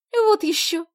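put-on high, loud huffy girl; indignant. A narrator's reading. Sonya rejecting the idea of inviting him, indignant. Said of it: "возмутилась Соня".

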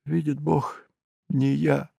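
тихо, жалобно, с паузой посередине; повторяй слабым голосом, будто сквозь слёзы; всхлипывая. A narrator's reading. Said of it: "всхлипывая". (тихая плачущая клятва)

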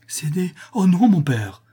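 high, pleading denial; shocked protest. A narrator's reading. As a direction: cry out in dismayed denial. Daughter protests to accusing father.

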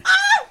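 sudden loud high scream; a scream of fright in the dark; startled scream. Acted. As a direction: jump out of your skin with a cry.

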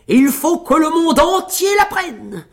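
high, loud boast; tailleur exalté. A narrator's reading. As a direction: proclaim it proudly to the world. Tailleur exalté par son exploit. (exalté, voix aiguë et forte)

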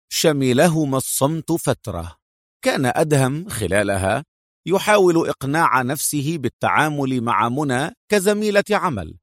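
usual speaking voice

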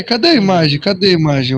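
ordinary talk, nothing marked

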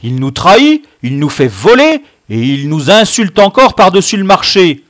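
loud, high indignant bellow; bellowing outrage. A narrator's reading. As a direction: rail at him, ending on an order. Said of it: "beugla Camus". (beugler d'indignation)

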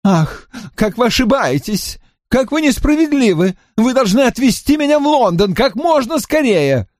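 high, impatient complaining voice; fretful Drawlight. A narrator's reading. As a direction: whine at the late rider.

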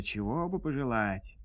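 ordinary delivery, nothing marked

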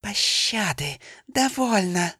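high, desperate plea to stop; begging for mercy. Acted. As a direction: cry out for mercy. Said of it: "кобра слишком быстро зашипела".

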